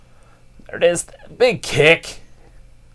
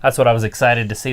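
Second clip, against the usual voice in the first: normal talking voice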